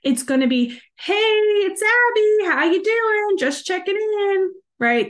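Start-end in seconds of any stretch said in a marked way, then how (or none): 1.0-4.5 high, drawn-out, sing-song phone greeting; novice follow-up call. Acted. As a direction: parody a beginner's check-in call, slow and high. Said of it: "That's, like, day one follow-up phone call".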